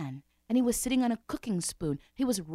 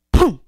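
boom; 4 semitones higher, 19 dB louder than usual. Acted. Crash effect.

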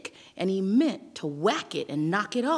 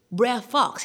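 ordinary delivery

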